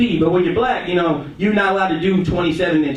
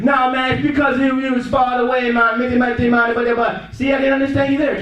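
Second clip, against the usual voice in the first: high, garbled mock Jamaican accent; comic, hard-to-follow Jamaican patois; 6 semitones higher than usual. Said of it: "He got a hard Jamaican accent".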